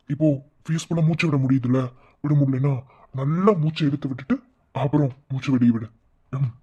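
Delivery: usual speaking voice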